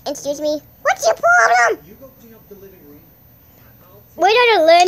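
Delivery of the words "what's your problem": much higher and louder squeaky take; high put-on voice. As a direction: squeak it back mockingly. Repeats in high voice.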